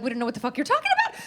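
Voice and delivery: rattled off fast; fast, careless dismissal; fast dismissive. Retold or imitated. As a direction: brush them off in a rush. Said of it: "They're like".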